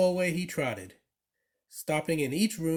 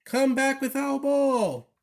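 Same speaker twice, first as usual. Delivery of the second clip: slow, high calling yell; 10 semitones higher, 4 dB louder than usual. A narrator's reading. Kids calling Marley to bring back their ball, slow high yell. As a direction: call after the runaway dog. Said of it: "yelled the kids".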